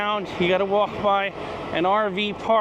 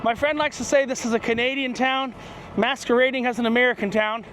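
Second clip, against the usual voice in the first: usual speaking voice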